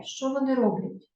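ordinary talk

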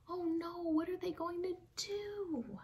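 high, frightened wail; panicked cry. Acted. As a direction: cry out in panic as the teacher vanishes. Kids panic as teacher vanishes.

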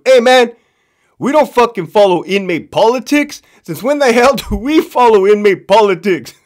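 high, forceful retold scolding; mock indignant rebuke. Captain B rebukes Captain C before everybody, mock indignant. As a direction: imitate a fed-up captain telling someone off.

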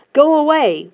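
a restrained shout to drive them off. A narrator's reading. Kid tells the gnomes closing in to leave, restrained shout. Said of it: "I shouted".

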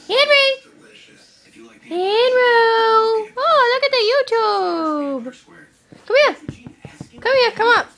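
high, loud sing-song call to a pet; calling the dog. Real, not acted.

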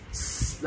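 "s" as a bare voiceless hiss; hissed s sound. Shown as a demonstration. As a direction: sound "s" alone, no vowel. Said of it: "là một âm gió". (âm gió "s" không rung)

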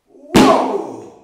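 shouted high whoa; bear's big swing cry; loud whoa. Acted. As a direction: yell it as the stick swings. Bear swings stick.

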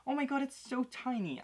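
high-pitched gushing over something tiny; cooing excitement. Real, not acted. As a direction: coo over the tiny figure. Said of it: "I'm sorry".

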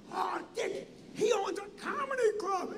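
high, wacky marvelling voice. Retold or imitated. Entertainer tells his brother about the club, high wacky wonder. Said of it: "these guys are a little wacky".

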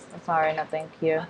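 usual speaking voice

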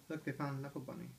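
plain reading voice

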